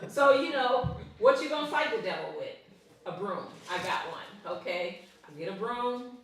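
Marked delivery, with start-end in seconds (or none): none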